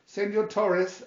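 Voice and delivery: plain reading voice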